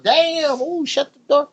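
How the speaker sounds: high mock passerby squeal; lightly hammed-up neighbor gripe; passersby complaining. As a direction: toss it off like a disgusted neighbor. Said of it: "People was walking by going".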